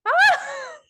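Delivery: high, loud shriek of a laugh; real laugh that bursts out; burst of laughter. Real, not acted. Host cracking up at the guest's story, loud shrieking laugh.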